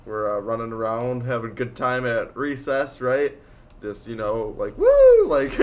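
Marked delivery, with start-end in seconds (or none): 4.8-5.3 high, loud playground "woo"; gleeful whoop. Retold or imitated. Storyteller acting out fun at recess, high gleeful whoop. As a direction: whoop it out high like kids at play.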